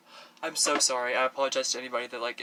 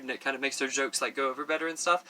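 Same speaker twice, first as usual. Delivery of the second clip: ordinary delivery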